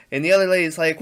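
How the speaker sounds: ordinary talk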